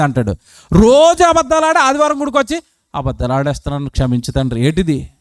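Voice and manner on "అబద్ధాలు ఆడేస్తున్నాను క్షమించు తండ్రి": mock confession. A habitual liar confesses at church on Sunday, mock meek and soft. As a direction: lower and softer, a hammed-up penitent.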